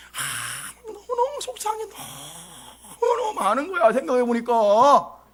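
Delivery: hammed-up sighing, tearful complaint; mock weeping. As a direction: reenact a self-pitying wail. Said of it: "막 눈물이 막 나더라고요". (과장되게 울먹이며 하소연)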